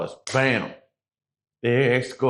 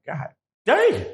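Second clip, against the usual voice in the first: impressed god damn; 9 semitones higher than usual. Retold or imitated. Reacting to huge weed amount.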